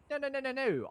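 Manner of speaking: high, loud string of denials; hasty protest. Retold or imitated. Man reassuring her he likes her, high loud protest.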